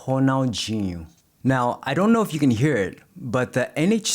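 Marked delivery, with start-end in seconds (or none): 0.0-1.1 Brazilian Portuguese accent. Shown as a demonstration. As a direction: say Ronaldinho like a Brazilian. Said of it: "how the Portuguese speaker says it".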